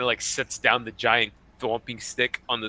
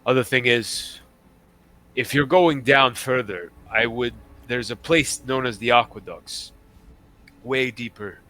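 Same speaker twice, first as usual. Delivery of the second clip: measured, slightly lowered captain voice; retired pirate captain; 3 semitones lower than usual. Acted. Captain briefing adventurers on a missing scroll, measured character voice. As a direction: confide the job with pauses, sinking at phrase ends.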